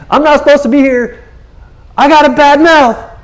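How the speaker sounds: Isaiah freaking out in God's council; hammed-up freak-out. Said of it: "He just starts freaking out".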